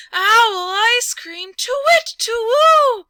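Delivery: high, loud owl hoot. Acted. Owl hooting with glee at the mouse's news. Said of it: "owl hooting delight".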